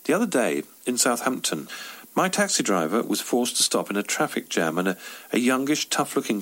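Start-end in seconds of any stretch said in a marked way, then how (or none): none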